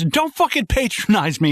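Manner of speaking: high-pitched mock indignation; acted angry interruption. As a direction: cut in, indignant, pitch leaping up.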